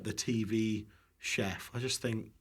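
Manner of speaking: normal talking voice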